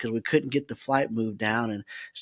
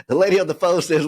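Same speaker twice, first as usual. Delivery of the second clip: ordinary talk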